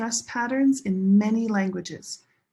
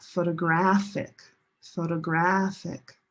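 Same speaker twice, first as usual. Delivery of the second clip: "photographic" with a held "GRAPH"; third-syllable stress. Shown as a demonstration. Teacher contrasting related words, stressed "GRAPH" in "photographic". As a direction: lean long on "graph" in "photographic". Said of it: "Different stress location".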